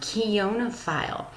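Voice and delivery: "Keonophile" slowly, opening on "kee"; pronunciation model. Shown as a demonstration. Teacher explaining the letter sounds, "Keonophile" said slowly with an E sound. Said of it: "that first I makes an E sound".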